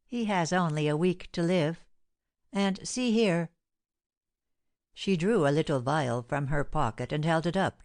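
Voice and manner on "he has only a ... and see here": usual speaking voice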